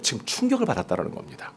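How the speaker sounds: ordinary delivery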